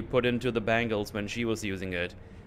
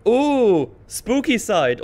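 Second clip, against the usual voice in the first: high, loud playful "ooh" of mock dread; mock spooky; 10 semitones higher, 8 dB louder, much slower than usual. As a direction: ham up the spookiness loudly, pitched high. Mock dread about the unknown side.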